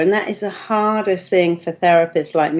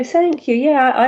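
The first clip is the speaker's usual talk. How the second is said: much higher, eager acceptance; bright thank you; 7 semitones higher than usual. Shown as a demonstration. Showing how to accept praise, bright and much higher. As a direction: accept the compliment gladly. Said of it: "just start to go".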